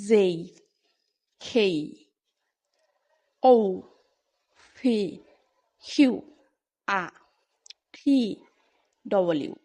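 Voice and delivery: খেই aspirated, ঔ rounded into a glide, আ without an r; corrected pronunciation. Shown as a demonstration. Teacher lists the letters whose sound changes, corrected pronunciation. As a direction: separate letters, যেই and খেই with glides, ফি puffed with air. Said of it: "এই কয়েকটার উচ্চারণের মধ্যে শুধু পরিবর্তন হবে".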